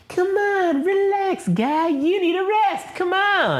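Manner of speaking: very high, slow, falsetto coaxing; a squeaky bro calming a buddy; mock soothing high voice. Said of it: "Vader's basically just like".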